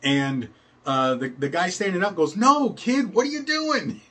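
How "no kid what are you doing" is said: put-on much higher and louder, a frantic teen's protest; exasperated teen boy. Teenage ringleader yelling at the kid who kicked wrong, high and frantic. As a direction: yelp at the kid like a panicked bossy teenager.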